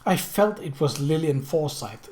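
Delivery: plain reading voice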